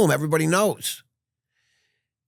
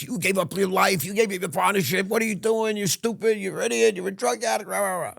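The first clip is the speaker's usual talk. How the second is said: higher nagging mother voice, scolding; imitating mother; 10 semitones higher than usual. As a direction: mimic a mother berating her son, pitched up. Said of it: "I'm hearing my mother's voice".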